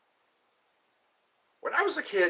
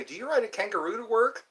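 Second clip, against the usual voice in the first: higher, naive tourist question; imitating American tourist; 7 semitones higher than usual. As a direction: lightly mimic a tourist asking in earnest. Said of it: "Americans come to Australia and they say".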